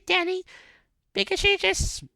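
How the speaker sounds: very high, light put-on voice of the mom; mom character voice. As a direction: squeak it out like a fussy mother.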